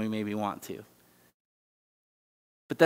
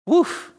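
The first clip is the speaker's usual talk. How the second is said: exasperated woof; 7 dB louder than usual. Real, not acted. Overwhelmed by marriage's challenges.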